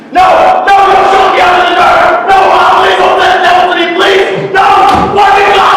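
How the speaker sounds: high, loud and fast pleading shriek; terrified screaming. Acted. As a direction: scream and beg not to be shut in. Boy terrified of the devil.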